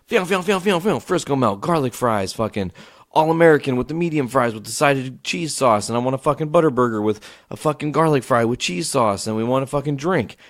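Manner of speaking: breathless-sounding rattle of menu items, retold; rapid-fire ordering. Customer hurrying to get the order in, rapid-fire. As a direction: reel off the order without stopping. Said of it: "So we quickly, and I'm talking just".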